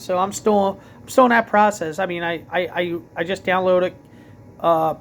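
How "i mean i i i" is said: normal talking voice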